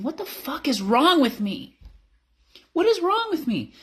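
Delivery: high, loud, emotional cry; anguished self-questioning. Retold or imitated. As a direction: demand it of yourself, near tears.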